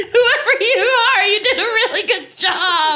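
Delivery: words through laughter; laughing. Real, not acted. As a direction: still laughing, high pitched. Laughing at voicemail.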